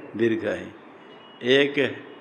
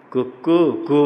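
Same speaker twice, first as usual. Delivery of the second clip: कुकु कु in short, long, longest vowels; rooster crow. Shown as a demonstration. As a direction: crow it with each syllable held longer. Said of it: "पहला एक मात्रा, उसका दीर्घ दो मात्रा". (मुर्गे जैसी बांग, हर कु और लंबा)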